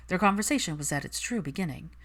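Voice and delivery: plain reading voice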